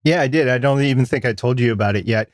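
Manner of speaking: ordinary talk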